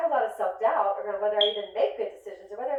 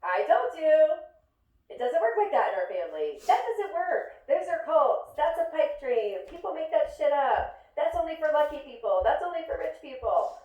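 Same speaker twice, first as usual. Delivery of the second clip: told-you-so family; 7 semitones higher than usual. Retold or imitated. Family after a failure, smug and scolding. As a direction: rub it in like an I-told-you-so uncle. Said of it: "they're like".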